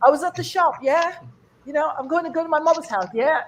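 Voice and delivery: high-pitched British mimicry; British accent. Comic showing how Brits seek a second opinion, British accent. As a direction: put on a high British voice, questioning at the end. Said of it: "something about British people".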